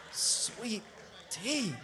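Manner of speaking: soft, spaced-out savoring; hushed reverence. Real, not acted. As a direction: drop to a hush, one word at a time. Soft reverent repeat after the shout.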